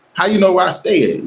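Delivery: high, pointed question; suspicious disbelief. Retold or imitated. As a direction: press him on how he found you. Confronting the ex.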